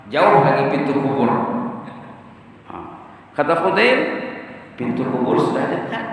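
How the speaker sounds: normal talking voice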